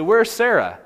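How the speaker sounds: loud high call of her name; sudden loud call across the tent; calling out loudly. Acted. Angels calling out for Sarah.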